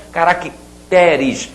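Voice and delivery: "caracteres" split slowly, weight on TE; ca-rac... TÉ-res, drawing out "caracteres"; slow "caracteres" with open stressed TE. Shown as a demonstration. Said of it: "A sílaba tônica passou a ser a sílaba seguinte".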